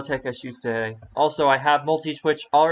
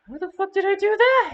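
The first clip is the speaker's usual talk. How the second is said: surprised; 4 dB louder than usual. Real, not acted. Surprised streamer reacting to game.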